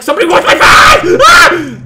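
high, very loud panicked yell; startled scream. Real, not acted. Player yelling as someone wants the fries, startled scream. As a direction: shriek in sudden alarm, voice shooting up. Startled by fry thief in game.

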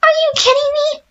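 high, whispery crying shriek; shrieking disbelief. Real, not acted. Gamer cornered by animatronics, crying shriek. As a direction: wail it in sheer horror. Caught by robots.

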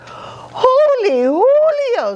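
very high, slow squeal. A narrator's reading. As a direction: squeal it out in shock. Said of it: "squealed the kitty boy".

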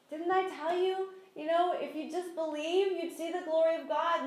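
mild chiding Jesus. Retold or imitated. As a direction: lightly voice Jesus correcting them. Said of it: "He's like".

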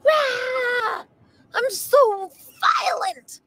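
hammed-up angry roar; mock violent rage. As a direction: play the raging upset person, big and loud. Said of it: "pretend like I'm the person who's upset".

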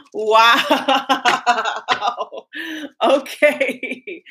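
breathless after laughing; out of breath. Real, not acted. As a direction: catch your breath between words. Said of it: "I can catch my breath there".

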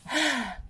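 high weary exhale; tired sigh. Acted. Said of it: "panted Rolly".

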